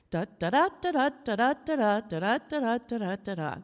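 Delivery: chanted da-DA beats, stress on every second da; iambic meter. Shown as a demonstration. Teacher showing a class iambic meter, chanted iambic stress. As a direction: punch the second da of each pair, evenly. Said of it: "written in iambic meter".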